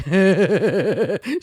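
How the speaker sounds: put-on giddy kid giggle; giggling. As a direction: giggle like a sixth grader. Kids giggling at innuendo.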